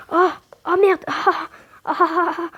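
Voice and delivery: loud, high-pitched cries of alarm; real startled yelp. Real, not acted. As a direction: yelp out, jolted, in short bursts. Caught off guard while playing. (cris de surprise, forts et aigus)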